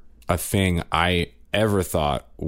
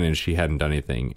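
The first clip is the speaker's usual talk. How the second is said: ordinary talk, nothing marked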